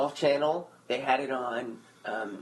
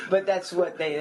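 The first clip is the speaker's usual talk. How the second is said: normal talking voice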